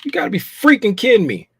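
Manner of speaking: exasperated disbelief. Real, not acted. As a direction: throw the words up in disbelief. Trade closed out, exasperated.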